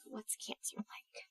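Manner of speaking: hushed, slow whisper to her aunt; whispered question. Retold or imitated. Asking her aunt in a slow hush. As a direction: breathe the question under the voice.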